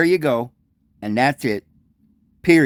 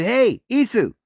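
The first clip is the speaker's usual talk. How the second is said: called out much higher, Hey, Isu; family calling to Jesus, high call; 8 semitones higher than usual. Retold or imitated. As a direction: hail him by his name, high.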